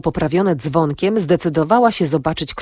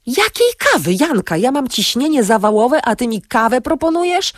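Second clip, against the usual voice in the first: exasperated outburst; 7 semitones higher than usual. A narrator's reading. Antek outraged at coffee offer.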